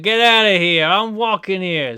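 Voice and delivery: loud, slow New York–Jersey bark; Jersey tough guy yelling on the street; Jersey accent. Retold or imitated. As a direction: shout it like a cabbie in traffic. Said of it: "He's like".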